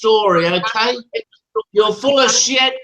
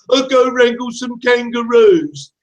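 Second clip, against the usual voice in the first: slow sneering mimicry of his line; drawn-out taunting echo of an insult; mocking repeat; slower than usual. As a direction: parrot his comeback back with scorn. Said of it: "and all you come back with is".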